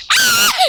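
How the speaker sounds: high piercing shriek; terrified scream. Real, not acted. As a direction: scream in terror. Caught by Granny.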